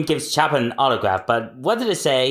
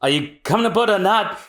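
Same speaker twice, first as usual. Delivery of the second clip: ordinary talk, nothing marked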